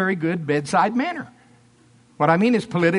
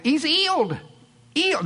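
put-on higher-pitched English accent, H dropped from "healed"; 8 semitones higher than usual. Healer to next in line, English accent. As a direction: imitate an English accent, saying "healed" as "eeled". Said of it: "in his English accent".

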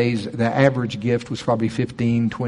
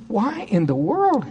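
incredulous; 10 semitones higher, slower than usual. Retold or imitated. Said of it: "I don't think I said it quite like that".

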